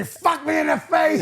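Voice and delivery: loud, high, drawn-out comic quote; mock shout. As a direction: belt out the punchline slowly, imitating a comic. Quoting Chappelle's line amid laughter.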